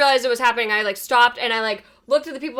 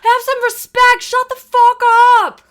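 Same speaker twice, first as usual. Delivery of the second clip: screaming; 12 semitones higher, 10 dB louder than usual. Retold or imitated. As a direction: scream at the drivers behind. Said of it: "screamed at the people behind me".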